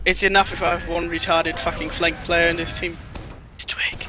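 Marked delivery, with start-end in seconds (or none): 3.5-4.1 whispered aside; whispering. Real, not acted. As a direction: hiss the name under your breath. Aside naming the teammate.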